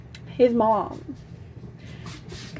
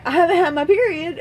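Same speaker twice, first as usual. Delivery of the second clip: higher, quicker insistent repeat; retold insistent repeat; 6 semitones higher, 4 dB louder, faster than usual. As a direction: blurt it out faster and higher. Repeats it after his question.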